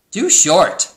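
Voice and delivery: high, loud cow verdict; blunt cow. A narrator's reading. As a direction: voice a cow's loud, blunt objection. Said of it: "said Cow".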